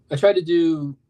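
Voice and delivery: ordinary delivery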